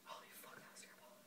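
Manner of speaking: barely voiced raspy whisper; hoarse whisper. Real, not acted. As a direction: rasp it, throat wrecked. Throat burning after the challenge.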